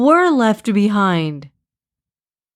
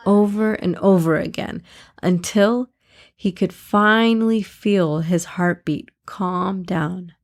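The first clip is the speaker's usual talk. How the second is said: usual speaking voice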